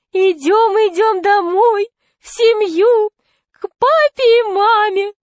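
put-on falsetto fat man coaxing a child; high-pitched, wheedling call to come along; thin high voice. A narrator's reading. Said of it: "сказал толстяк тонким голосом".

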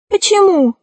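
high-pitched, plaintive "why?"; sorcerer's protest. A narrator's reading. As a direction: ask it high, with a falling end. Sorcerer questions the rat's scolding.

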